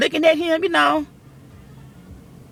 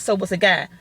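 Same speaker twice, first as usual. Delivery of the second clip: usual speaking voice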